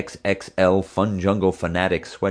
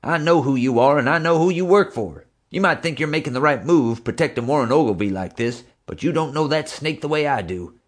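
higher, louder furious tirade; angry tycoon; 8 semitones higher, 3 dB louder than usual. A narrator's reading. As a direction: hammer him with threats then a deal. Angry J J lecturing suspect.

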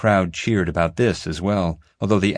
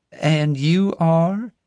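slow, higher Southern drawl; southern lady; 10 semitones higher, 3 dB louder, much slower than usual. A narrator's reading. Flora introducing herself to the kids, slow Southern drawl. Flora asks Teddy's name.

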